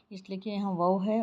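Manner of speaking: normal talking voice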